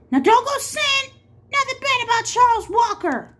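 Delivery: high, loud sheriff voice; warning. Acted. Sheriff cutting Catherine off, loud and high. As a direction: cut her off sharply.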